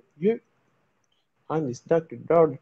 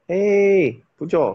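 calling out; 8 dB louder, much slower than usual. Real, not acted. Calling out to friend Phoe Kyaw.

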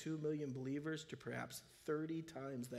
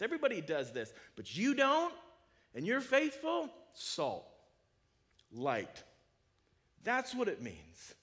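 loud, high-pitched pulpit fervor; full-force preacher's call; fiery preaching; 9 semitones higher, 9 dB louder than usual. Acted. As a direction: preach it out to the whole room, rising.

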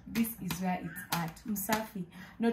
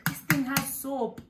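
higher, louder, slow mock child voice; imitating child; 6 semitones higher, 5 dB louder than usual. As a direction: play the puzzled little girl, slowly. Quoting daughter Bella.

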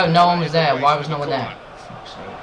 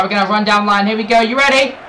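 climbing higher and louder to the end; mounting anticipation; building excitement; 5 semitones higher, 5 dB louder than usual. Real, not acted. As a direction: wind up for the big attack. Building up an attack.

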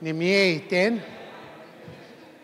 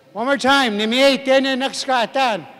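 raised, higher teaching voice; loud rallying call; 4 semitones higher, 5 dB louder than usual. Shown as a demonstration. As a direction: call the class to repeat it. Teacher rallying class to repeat.

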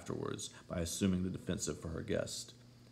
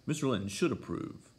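firm, slightly raised reply; decisive; 5 semitones higher, 4 dB louder than usual. A narrator's reading. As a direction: settle it with decision. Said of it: "returned my lady decisively".

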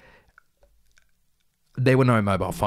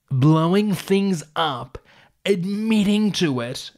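incredulous; 8 semitones higher, 4 dB louder, slower than usual. Real, not acted. Incredulous mock emphasis.

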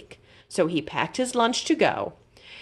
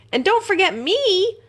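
high squeaky worried aside; a tiny voice begging not to be forgotten; small mouse plea; 12 semitones higher, 3 dB louder than usual. A narrator's reading. Mouse afraid of being left on the bus, high plea. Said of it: "Fergus thought".

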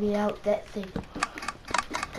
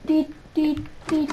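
urgent calling; 7 semitones higher, 6 dB louder, slower than usual. Real, not acted. Urgent call to friend.

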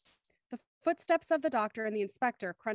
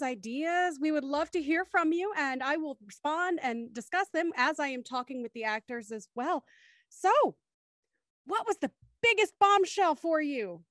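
ordinary talk, nothing marked

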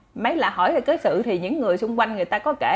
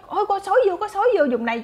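alarmed shout; 10 semitones higher than usual. Acted. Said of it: "nói".

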